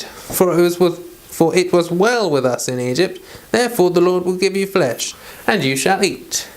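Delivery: normal talking voice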